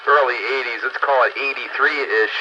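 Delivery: ordinary delivery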